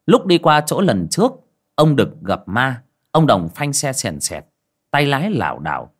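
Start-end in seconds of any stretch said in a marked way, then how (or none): none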